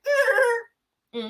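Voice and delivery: high squeaky mm-hmm; a high knowing hum. Retold or imitated. Marceau's reaction, retold.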